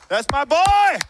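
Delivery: high, loud whoop of a proud fan; cheering superfan. Acted. A number one fan in the stands cheering his player, proud shout. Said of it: "he's like".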